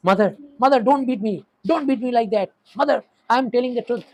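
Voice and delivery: high, loud frightened pleading with mother; delirious pleading cry. Acted. Feverish boy begging his mother, loud frightened plea. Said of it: "ऐसे बोल रहा है".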